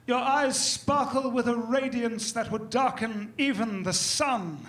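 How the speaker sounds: mock English accent, slow and grand; hammed-up English courtly wooing; posh English suitor. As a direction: woo her like a caped English poet.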